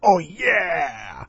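excited cheer. Pretend.

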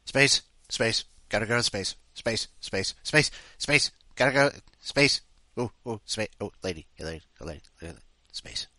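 babbling space-obsessed robot chant; Space Core impression. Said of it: "See you in space".

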